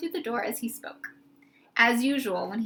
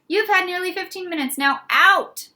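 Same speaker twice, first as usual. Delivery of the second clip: louder and higher, a firm order; 8 semitones higher, 8 dB louder than usual. A narrator's reading. Matron ending the visit, firmly ordering the friends out. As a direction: shoo him out with authority. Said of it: "she said firmly".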